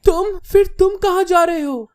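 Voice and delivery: high, loud anxious question; very nervous. A narrator's reading. Girl asking where the hero is going, panicky. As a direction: call out high in alarm. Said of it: "बहुत ज्यादा घबराते हुए कहती".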